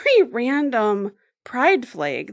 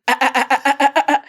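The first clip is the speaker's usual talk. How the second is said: rapid staccato "ah" cries; imitated pulsating; 3 semitones higher, 5 dB louder, much faster than usual. Storyteller imitates Omar looking up at the ceiling, staccato pulsating. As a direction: pulse out short, even bursts of "ah". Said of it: "starts to do the pulsating".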